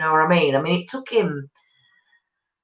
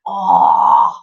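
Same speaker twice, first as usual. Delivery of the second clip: low, pained "oh" of sympathy; deep sympathetic moan; sympathetic groan; 12 semitones lower, 6 dB louder than usual. Real, not acted. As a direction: wince out loud for her. Reacting to back-to-back birth.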